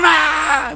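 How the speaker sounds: imitated high wail; mimicked child crying out; child's scream. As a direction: yelp like a howling child. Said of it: "Más gritó".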